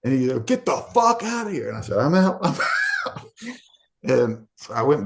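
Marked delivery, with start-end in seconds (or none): 0.4-1.7 high mock-yelling cop; guard ordering him out, high and rough. 2.0-3.2 high laughing shout of joy; exultant shout. Retold or imitated. Exultant exit.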